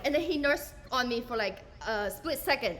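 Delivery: normal talking voice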